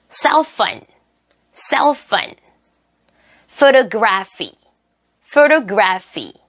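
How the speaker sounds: careful model words with PH said as F. Shown as a demonstration. Teacher models PH words for learners, with clear F sounds. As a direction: say each PH as a clear English "f". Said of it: "F 발음으로 한번 해보도록 할게요".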